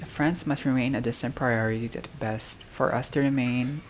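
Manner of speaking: usual speaking voice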